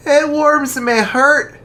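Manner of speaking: high, loud, slow kindly priest voice; jolly archbishop. Acted. Archbishop asking the lads why, hearty and slow. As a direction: ask gently but heartily.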